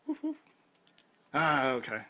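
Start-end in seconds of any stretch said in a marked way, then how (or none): 0.1-0.3 high, soft mock barking; dog barks. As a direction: bark like a small dog.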